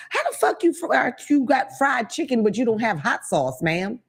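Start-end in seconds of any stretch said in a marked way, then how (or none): 0.1-3.0 outraged disbelief. Real, not acted. At the Frenchie's clerk, high outraged disbelief.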